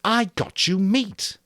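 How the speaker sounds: high, loud, slow furious yell; Jack's rage. A narrator's reading. Jack raging at the circle of boys, a loud high yell. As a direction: hurl it at the boys. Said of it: "his rage elemental and awe-inspiring".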